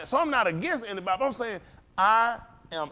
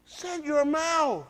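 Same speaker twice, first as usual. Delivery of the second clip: slow, deliberate put-down; slow scolding aside; stern rebuke; 6 semitones higher, much slower than usual. Acted. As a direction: spell it out slowly and firmly. Preacher rebuking Herschel Walker.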